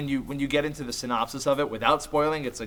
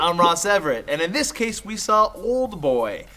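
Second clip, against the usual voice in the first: normal talking voice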